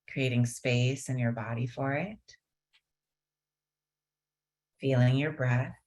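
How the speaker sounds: unhurried calming guidance; guided meditation. Acted. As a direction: ease them gently into stillness.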